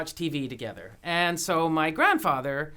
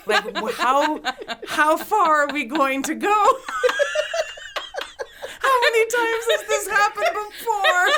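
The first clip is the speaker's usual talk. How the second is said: laughing; 5 dB louder than usual. Real, not acted. Retelling awkward scene, laughing.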